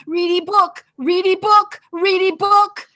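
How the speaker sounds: put-on loud high child's chant rising in pitch. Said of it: "It just got higher and higher".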